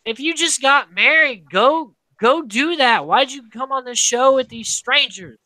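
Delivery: mock-scold her for skipping the honeymoon; incredulous scolding. Podcaster scolding Nicole for leaving her honeymoon, exasperated. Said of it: "And I'm like".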